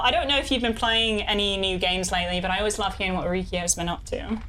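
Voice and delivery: ordinary delivery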